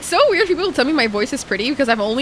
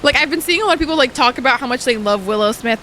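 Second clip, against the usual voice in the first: ordinary delivery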